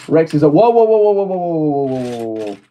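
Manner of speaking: catching something about to fall; alarmed whoas. Real, not acted. Rex figure slipping apart, rising alarmed whoas.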